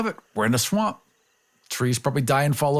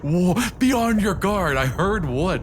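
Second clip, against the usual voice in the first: mock alarm; 7 semitones higher than usual. Mock alarmed warning about wood.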